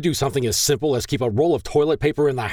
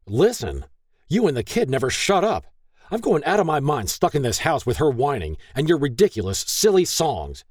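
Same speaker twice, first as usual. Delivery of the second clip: higher-pitched, exasperated ranting; angry tirade; 8 semitones higher than usual. A narrator's reading. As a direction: rant at the wife, fed up. Acted tirade of the furious husband.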